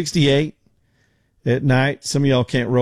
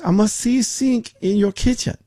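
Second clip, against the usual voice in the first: high-pitched mock Filipino accent; terrible Filipino accent; 10 semitones higher than usual. Agent demanding to see the kitchen sink, mock Filipino accent. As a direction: imitate an insistent agent, badly faked Filipino accent. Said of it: "That's a terrible accent".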